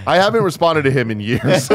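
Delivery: laughing through the line. Retold or imitated. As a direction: say it while cracking up. Retelling own reply, laughing.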